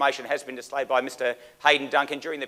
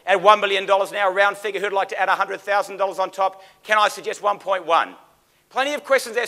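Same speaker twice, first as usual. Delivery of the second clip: auctioneer; 7 semitones higher, 5 dB louder than usual. Acted. Auctioneer calling for bids.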